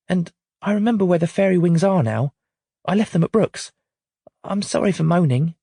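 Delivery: put-on high, small apologetic child voice; the girl says sorry to her mum; apologetic little girl. A narrator's reading.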